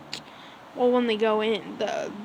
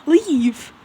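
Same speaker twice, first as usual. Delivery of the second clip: higher, louder sharp command; mock angry order; 5 semitones higher, 8 dB louder than usual. Deku sending staring onlookers away, mock angry shout. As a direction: order the gawkers out, retold.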